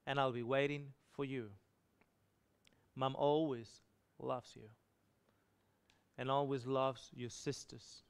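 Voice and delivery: a weak mother's loving goodbye, gently paced; tender farewell. Retold or imitated. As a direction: speak softly and tenderly, with pauses. Dying mother's words to son.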